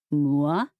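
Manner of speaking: mock French "Moi?" said low; terrible French accent. Rufus plays innocent to Pepper, bad French accent. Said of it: "he said in a terrible French accent".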